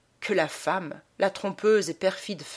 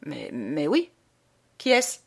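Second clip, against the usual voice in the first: stammering; 2 semitones lower than usual. A narrator's reading. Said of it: "Et le prêtre balbutia".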